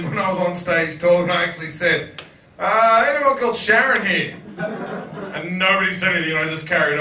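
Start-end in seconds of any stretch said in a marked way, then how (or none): 2.6-4.4 high, loud call to a crowd. Retold or imitated. Performer on stage seeking Sharon, calling out. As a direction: re-enact asking the whole room.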